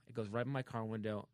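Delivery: usual speaking voice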